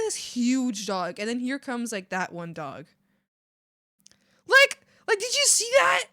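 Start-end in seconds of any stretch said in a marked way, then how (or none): 4.5-6.0 much higher and louder, thrilled; pointing at the screen in awe; excited disbelief. Real, not acted. Excited watching dog video.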